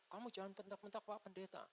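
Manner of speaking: high-pitched imitated motherly telling-off; scolding mother. Mother tells her daughter not to snap at the pastor, scolding. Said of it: "mamanya bilang".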